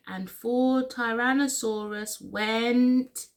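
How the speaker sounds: ordinary delivery, nothing marked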